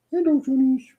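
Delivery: put-on baby-talk greeting to the cat; cutesy greeting. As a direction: sing it out to the cat.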